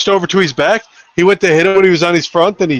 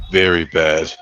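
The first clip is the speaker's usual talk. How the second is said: grave warning; 11 semitones lower, slower than usual. Retold or imitated. Retells young boy's grave warning.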